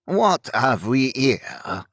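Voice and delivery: put-on slow, grated French-accented robber; thick French accent. A narrator's reading. French-accented highwayman first confronts the carriage, grating and slow. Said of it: "low-pitched voice grated out in a thick French accent".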